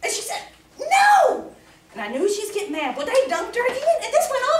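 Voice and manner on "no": a sharp, loud, high "No."; a baptized woman snapping her refusal at the preacher; angry no. Retold or imitated. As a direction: shout it like she's fed up.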